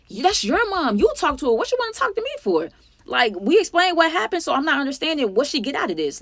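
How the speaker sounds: high-pitched exasperated protest; retold rant at a friend, indignant; going off. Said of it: "now I start going off on Ashley".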